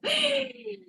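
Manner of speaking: very high short giggle; a squeal of a laugh. Real, not acted. Host laughing at the co-host's joke, high giggle.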